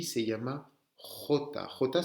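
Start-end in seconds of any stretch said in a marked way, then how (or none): 1.5-2.0 J named as "khota", rasped in the throat; jota espagnole. Shown as a demonstration. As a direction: say J as Spanish jota with guttural onset. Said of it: "c'est le nom de la lettre". (jota au son raclé)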